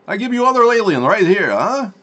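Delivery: high, loud hammed-up Russian offer; mock Russian accent. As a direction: show off the picture like a cartoon Russian.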